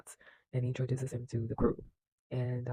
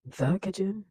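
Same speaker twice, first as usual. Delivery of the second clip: higher, louder "The kitchen?" as a startled question; incredulous echo; 6 semitones higher, 6 dB louder, slower than usual. Retold or imitated. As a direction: echo "The kitchen?" back with raised pitch and force, imitating disbelief. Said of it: "Why do you say it like that?".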